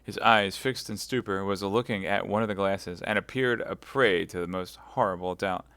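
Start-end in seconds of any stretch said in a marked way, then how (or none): none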